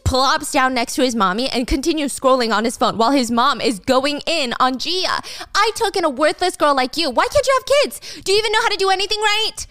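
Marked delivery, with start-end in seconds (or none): 5.5-9.7 much higher shrieking scolding, fast at the end; berating mother-in-law. Retold or imitated. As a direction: tear into the daughter-in-law.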